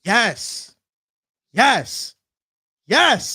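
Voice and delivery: emphatic, rising-pitched cries of yes; excited approval. Real, not acted. Cheering on co-host's pick.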